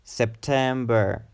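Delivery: September with a lengthened, stressed TEM; slow "September", leaning on the middle syllable TEM; stress on second syllable. Shown as a demonstration. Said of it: "emphasis is on the second syllable".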